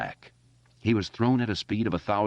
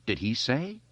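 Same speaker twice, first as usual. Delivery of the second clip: usual speaking voice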